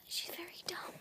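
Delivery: hushed teasing aside; whispering. Real, not acted. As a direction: whisper it behind her back. Aside about friend.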